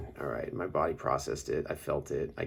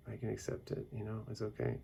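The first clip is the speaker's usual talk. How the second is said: much softer, high reassuring words; soft self-reassurance; 7 semitones higher, 8 dB quieter, faster than usual. Retold or imitated. Soothing herself about her emotions, soft reassurance. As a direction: settle yourself gently. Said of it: "And I'm just like".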